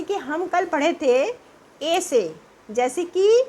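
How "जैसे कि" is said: ordinary talk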